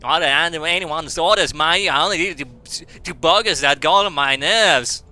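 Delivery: mock British sliding into Australian; broad, badly faked British-Australian accent. Voicing the British game character's lines, Australian-sounding British accent. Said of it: "I can't do British".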